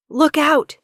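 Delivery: high, loud warning scream; Sarah screaming to warn the king. Acted. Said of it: "she screams".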